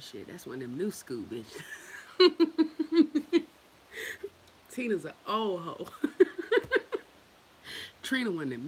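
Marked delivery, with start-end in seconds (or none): 1.7-3.5 loud, high cackling laugh. Real, not acted. Laughing with the chat. 5.9-7.0 loud, high cackling laugh. Real, not acted. Laughing with the chat.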